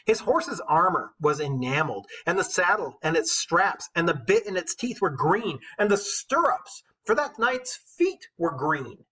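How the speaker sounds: put-on measured storytelling read of an old epic; steady, rhythmic verse narration; poem reading. A narrator's reading. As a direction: read it like a bard to the class. Teacher reads epic poem aloud.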